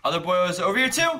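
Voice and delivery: loud, high and fast; being chased. Real, not acted. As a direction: blurt it out in a hurry. Chased, spotting another enemy.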